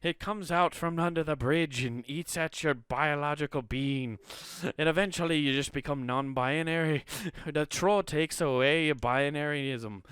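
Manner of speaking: put-on joking Scottish accent, close to cracking up; mock Scottish accent. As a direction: tell it like a Scottish troll tale. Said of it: "I'll stop with the fucking Scottish accent".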